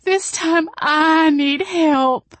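very high, slow, loud wail. A narrator's reading. Jolene begging Jane for help, slow high tearful wail. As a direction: plead through tears, drawn out. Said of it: "Jolene wailed".

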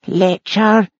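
high, plaintive echoing wail; wailed, wounded accusation. Acted. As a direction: echo the insult as a high lament. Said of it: "but in a plaintive wail".

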